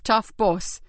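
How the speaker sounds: ordinary delivery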